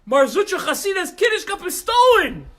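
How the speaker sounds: loud, high, breathless alarm; a student rushing in, shouting; frantic shout. Acted. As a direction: burst in yelling the news. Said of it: "comes running in and he says".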